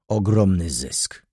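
ordinary delivery

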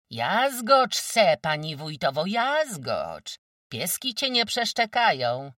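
high, loud, jeering shout; shouting mockery. Kozłowa taunting the wójt's wife, loud jeering shout. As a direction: hurl it across the yard to mock her. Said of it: "wołała".

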